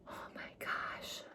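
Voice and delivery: breathy whispered exclamation; whispered oh my gosh. Real, not acted. Overwhelmed vlogger whispering to her viewers. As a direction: let it slip out as a whisper.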